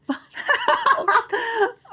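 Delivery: high, loud real laugh; loud burst of laughter. Real, not acted. Remembering the bourbon mix-up, a loud real laugh.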